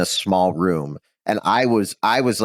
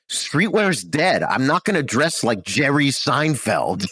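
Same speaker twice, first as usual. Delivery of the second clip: put-on Brooklyn accent; 6 semitones higher, slower than usual. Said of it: "he's got this thick Brooklyn accent".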